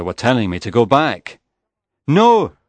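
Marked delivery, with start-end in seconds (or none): 2.1-2.6 high, loud shout of refusal. A narrator's reading. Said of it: "I shouted".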